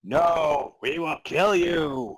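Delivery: slow, eerie ghost threat; a lightly voiced zombie ghost. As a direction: menace them as a ghost. Zombie ghost reply in reading.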